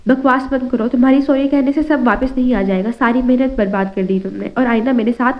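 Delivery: usual speaking voice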